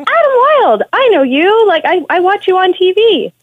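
high, excited fan gushing at a TV host; starstruck lady fan. Retold or imitated. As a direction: squeal it like a thrilled stranger on the street. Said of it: "she's like".

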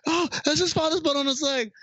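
high put-on character voice. Retelling the movie, squeaky high character voice. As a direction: pitch it way up, like a cartoon. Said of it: "He's like".

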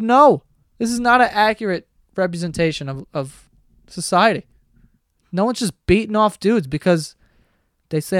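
ordinary talk

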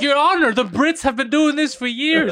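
high, loud mock plea to a judge; hammed-up courtroom protest, slowing down; defendant pleading in court. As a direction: plead your case with exaggerated indignation. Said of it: "in court, he's like".

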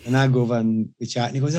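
ordinary delivery

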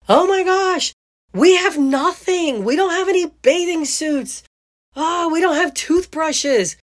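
shrill-high panicked mom tone, slowing as it sinks in; high-pitched disbelief. Retold or imitated. Mom realizing the bags were left behind, high-pitched disbelief. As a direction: squeak out the list of missing things in disbelief. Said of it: "that high-pitched tone of disbelief".

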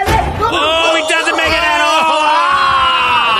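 loud slow shout at the fall; excited shout. Real, not acted. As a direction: yell out as he misses. Watching a parkour fail video.

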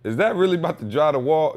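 high, disbelieving ask; mock incredulous question; incredulous retold question. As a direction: pitch it up in disbelief. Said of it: "And I'm saying".

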